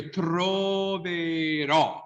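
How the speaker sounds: stretched "troverò", closed first O, open last O; two O sounds. Shown as a demonstration. Teacher letting students hear two different Os in "troverò". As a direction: draw out each vowel of "troverò" to contrast them. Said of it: "is two different vowel sounds".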